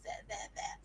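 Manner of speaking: whispered there there, very soft; Hollywood soothing. Retold or imitated. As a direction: whisper like a film hero comforting her. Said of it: "the Hollywood equivalent of".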